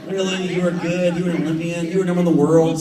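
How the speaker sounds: put-on high, loud disbelieving kid voice; incredulous kids. His sons doubting his Olympic past, incredulous and loud. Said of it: "It's gonna be like".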